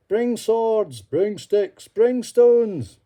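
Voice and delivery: loud rallying shouts; calling out. Acted. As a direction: rouse the crowd to arms. Villagers calling to arm.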